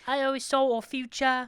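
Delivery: high put-on British mum; imitated British mom. Co-host echoing the mom's regret, high British voice. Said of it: "they cut to the mom".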